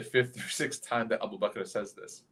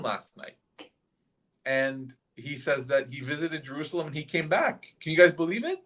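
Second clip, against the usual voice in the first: higher-pitched jeering announcement; mocking tone; 5 semitones higher than usual. Abu Jahl telling the gathered people about Muhammad's claim, mocking. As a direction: call the crowd in to share the ridicule. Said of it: "with that mocking tone".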